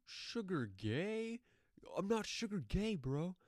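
high-pitched mock outrage; imitated indignant singer. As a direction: mimic offended disbelief, first words stretched out. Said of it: "[name] is just going in".

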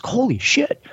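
high retold surprise; astonished. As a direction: act the clerk's jaw-drop. Employee learns who grandfather is.

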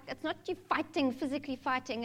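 usual speaking voice

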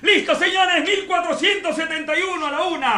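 loud, high auctioneer countdown; auctioneer call. Pretend.

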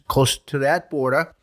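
normal talking voice

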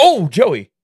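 high, loud comic realization; mock sudden remembering. Parent imitated suddenly remembering son Joey, mock surprised. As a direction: exclaim as if it just dawned on you.

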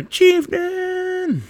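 very high squealed callout; comic high-pitched alarm; imitated Marine alarm. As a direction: squeal a mock warning to the squad. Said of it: "I guarantee Marines are".

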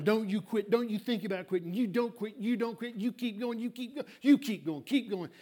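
urgent coach chant without full volume; mock yell, repeated in a driving rhythm; retold coach's yelling. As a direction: imitate a coach urging him on, relentlessly. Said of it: "he's yelling at him all the way down".

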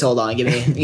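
ordinary talk

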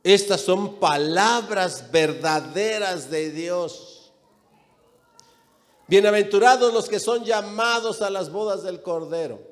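forceful, measured pulpit reading; scripture declamation. Acted. Preacher reading the angel's words to John, declamatory. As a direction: proclaim the verse to the whole congregation.

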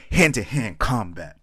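animated redo of the poem line; really animated. Acted. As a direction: punch each word out, slowly and loud. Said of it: "I had to get really animated with that one".

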